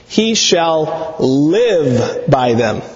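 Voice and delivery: each word drawn out slowly and weighted; slow emphatic. Acted. Preacher slowly weighting the Torah promise to his congregation. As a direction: lay the verse down slowly, word by word. God's words paraphrased with emphasis.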